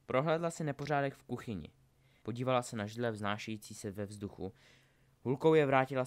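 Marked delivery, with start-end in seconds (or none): none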